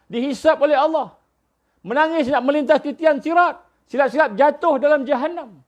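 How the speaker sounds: high-pitched urgent sermon delivery; impassioned preaching. Acted. (khutbah bersemangat, nada tinggi)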